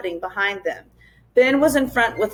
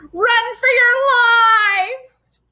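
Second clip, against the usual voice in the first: very high, loud bird screech; screaming bird; 13 semitones higher, 6 dB louder, slower than usual. A narrator's reading. The wiffle bird screaming at the children. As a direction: shriek it like a frantic bird. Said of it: "the wiffle bird screamed".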